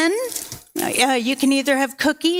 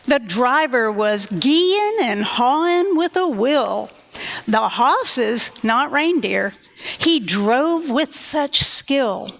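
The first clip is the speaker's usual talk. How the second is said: put-on lilting rhyme read to children; story narration; 2 semitones higher, slower than usual. A narrator's reading. Santa poem read aloud to children, lilting storyteller voice. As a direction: read the poem to the kids, with a bounce.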